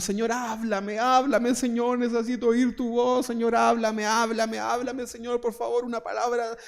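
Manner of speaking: high, insistent mock begging; pleading prayer. Preacher mimicking a needy believer begging God, mock pleading. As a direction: beg God over and over, hammed-up.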